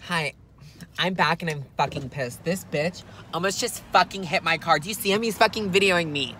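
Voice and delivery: normal talking voice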